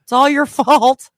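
high, loud accusing wail; acted stoned hysteria; mock freak-out. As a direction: blame them in a hammed-up panic. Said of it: "I'm freaking out to the point saying".

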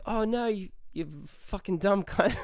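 high-pitched mock Aussie insult; heated Australian accent. Arguing back at the girls, heated fake Australian accent. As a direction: fake Aussie outrage, about to crack up. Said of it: "turned into a little bit of an argument".

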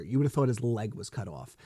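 ordinary delivery

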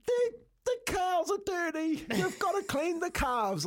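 put-on very high, squealing kid voice; imitated distressed daughter. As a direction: mimic a screaming girl in a panic. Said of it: "like this".

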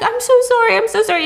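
high, hurried sorry; flustered apology. Acted. Jules apologises after pushing her.

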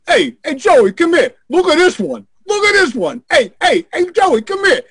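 brash Italian-American shout to a buddy; Italian calling out. Retold or imitated. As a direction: call him over like a big Italian guy. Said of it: "they're both really very Italian".

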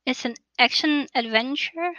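ordinary talk

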